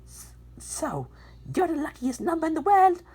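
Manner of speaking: hesitant character voice. Acted. Character asks in story.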